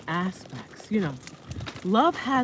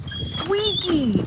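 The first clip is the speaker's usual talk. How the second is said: high mock squeak; 11 semitones higher than usual. Imitating stroller squeak.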